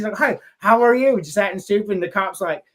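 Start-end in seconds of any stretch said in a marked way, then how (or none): 0.2-1.2 lightly imitated bluffing bug man, louder and higher; fake accent. Fletch greeting the cop in disguise, lightly faked accent. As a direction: put on a hint of phony accent. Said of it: "he's got this fake mustache and fake accent".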